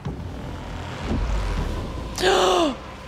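shocked gasp; sharp gasp. Real, not acted. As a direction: gasp as if something slips away. Hat floating away.

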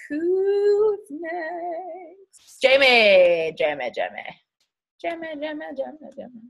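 sing-song name calling, high and drawn out. Real, not acted. Host calling Jamie to speak next, sing-song call. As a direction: call her name like a playful chant.

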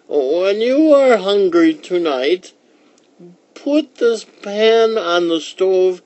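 put-on high, loud, slow old lady voice; slow high-pitched old-woman character voice. A narrator's reading. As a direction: play the old woman, stretching words out high. Old woman teaches magic words.

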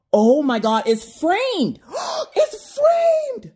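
high-pitched, gushing, slowed oh-my-goodness; overwhelmed by the design, gasping with delight; real excitement. Real, not acted. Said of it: "I got real excited just now".

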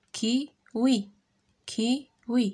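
Kiwi split into two beats, ki, wi; syllable by syllable. Shown as a demonstration. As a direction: leave a gap inside Kiwi. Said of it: "Frappe les syllabes dans tes mains".

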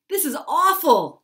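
high, loud wail; a high, loud panicked cry. Acted. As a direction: cry it out in panic as you run. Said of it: "cried Jim as he ran".

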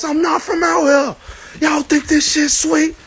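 high, pushed yell at the crowd; retold yell. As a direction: shout it like you mean it. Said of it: "I'm steady yelling at they ass".

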